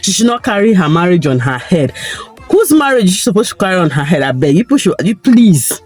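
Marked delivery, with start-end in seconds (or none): none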